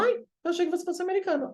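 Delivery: chirpy impersonation of the woman; mock surprised lady; mock high girly voice. Retold remark by a woman.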